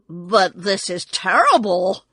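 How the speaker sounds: loud, troubled protest; dismayed. A narrator's reading. Tin Woodman hearing Oz is a fraud, dismayed.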